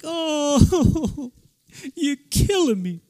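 high, loud moaning dog voice; dismayed talking dog. Retold or imitated. Dog hearing the bacon got eaten, hammed-up high moan. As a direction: wail it like a tormented dog, hammed-up. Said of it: "It's like".

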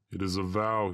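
ordinary delivery

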